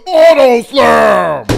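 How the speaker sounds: loud, high, drawn-out shout; shouted catchphrase. Pretend. Host cuts into the cheating story, shouted catchphrase. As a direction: belt it out slowly at the top.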